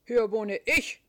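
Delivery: loud, changed dog voice yapping; yapping terrier. Acted. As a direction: yap it out like a cheeky little dog. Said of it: "kläfft der Terrier".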